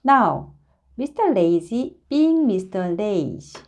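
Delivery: usual speaking voice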